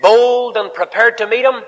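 high, loud, ringing sermon call; full-force preaching. Acted. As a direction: preach it out to the whole hall.

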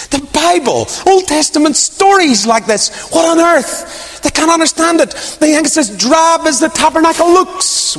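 high-pitched mock disbelief; scandalized wonder at something so drab; incredulous. Outsiders' disbelief at Bible study.

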